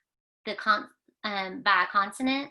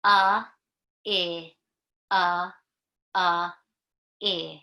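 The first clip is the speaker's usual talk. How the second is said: alien talk; 2 semitones lower, 4 dB louder, slower than usual. Pretend. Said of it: "look at this guy".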